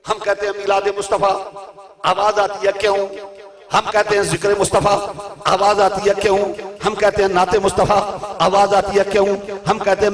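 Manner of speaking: loud impassioned sermon voice; fiery preaching. Acted. Preacher rousing the gathering on love and reason, fiery. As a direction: drive the words at the congregation.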